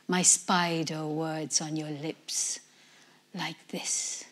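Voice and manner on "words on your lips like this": very soft whisper in the ear; ghost whisper. Shown as a demonstration. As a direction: trail off into a whisper. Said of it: "My ghost voice whispering in your ear".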